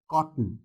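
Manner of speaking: कॉटन with a tiny ट and no second vowel; कॉटन as cot-n; syllabic n. Shown as a demonstration. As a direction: release ट directly into n in कॉटन. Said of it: "ट sound है वो बहुत ही छोटा है".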